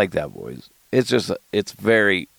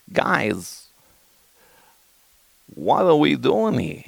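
halting, tentative Walken impression; Christopher Walken; 6 semitones higher, slower than usual. Comic testing a hard impression, loose Walken cadence. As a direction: try Walken's cadence with a long pause. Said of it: "trying to do Christopher Walken".

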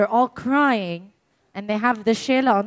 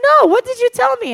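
much higher, innocently puzzled; 13 semitones higher, 6 dB louder than usual. Retold or imitated. Grieving friend asking what was said, high and puzzled. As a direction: ask it high and blankly confused. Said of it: "She said".

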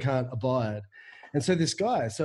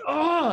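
high-pitched "oh" of exasperation; pent-up cry; 13 semitones higher, 4 dB louder than usual. Retold or imitated. Surfer re-enacting his urge to hit something, exasperated cry. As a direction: let pent-up anger burst out in one cry.

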